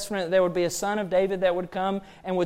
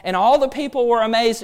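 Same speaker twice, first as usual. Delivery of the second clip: ordinary talk, nothing marked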